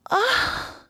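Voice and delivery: a high sung-out "Ah"; mock podcast sigh. Shown as a demonstration. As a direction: toss up a high airy "Ah" like a radio host. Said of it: "that sounded better than my normal voice".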